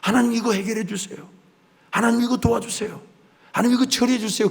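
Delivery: hammed-up begging prayer; pleading petitioner. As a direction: mimic a needy churchgoer pleading.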